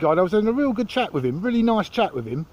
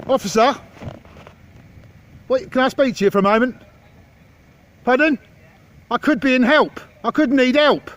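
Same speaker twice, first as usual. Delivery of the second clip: raised, mock-helpless call to the officer; 6 semitones higher, 4 dB louder than usual. Real, not acted. Filmer calls the police officer over, raised mock plea. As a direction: call across with fake need, higher and louder.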